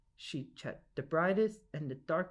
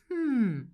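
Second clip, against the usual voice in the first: loud high musing hum; a considering hmm, drawn out; thoughtful hmm; 8 semitones higher, 6 dB louder than usual. Acted. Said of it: "the lady thought".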